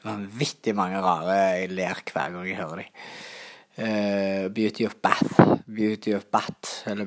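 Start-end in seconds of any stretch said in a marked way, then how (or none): 4.5-5.3 English pronunciation. Shown as a demonstration. English name read out to viewers in the proper English way. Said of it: "sånn norsk, eh, engelsk". 5.7-6.8 "Beauty of Bat" said slowly, with a hard t instead of th; Norwegian-style English. Shown as a demonstration. The Hardanger growers' version for viewers, slow Norwegian-style English. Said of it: "sånn norsk, eh, engelsk".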